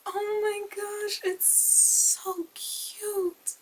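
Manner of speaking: very high, drawn-out cooing squeal; delighted squeal. Real, not acted. Unboxer sees the purple palette, squealing with adoration. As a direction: stretch the words out in high-pitched delight.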